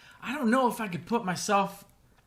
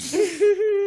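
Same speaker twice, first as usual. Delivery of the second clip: polite refusal; 12 dB louder than usual. Retold or imitated. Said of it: "She licked it and then said".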